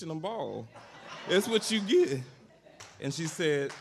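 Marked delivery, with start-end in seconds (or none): 0.0-2.2 laughing punchline. Real, not acted.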